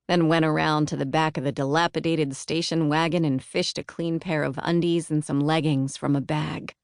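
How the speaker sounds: plain reading voice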